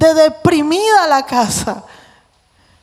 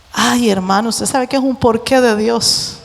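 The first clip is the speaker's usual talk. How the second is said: ordinary talk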